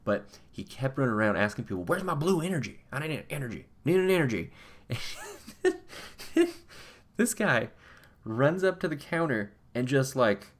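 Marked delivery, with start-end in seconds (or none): 1.8-4.5 higher, insistent, a bit whiny; frantic mock pleading. Man keeps asking for blue energy. 4.9-6.8 breathy high-pitched giggle; high airy laugh. Real, not acted. Laughing at the story.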